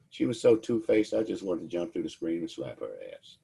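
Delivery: normal talking voice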